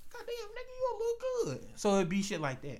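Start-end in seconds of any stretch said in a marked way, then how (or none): 0.1-1.8 flirty catcall. Retold or imitated. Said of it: "You be like".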